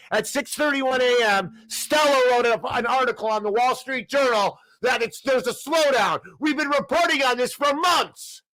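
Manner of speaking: real high-pitched exasperated shouting; angry outburst. Real, not acted. Host protesting months of ignored reporting, angry outburst. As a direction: build to an indignant high cry. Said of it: "it makes me so angry".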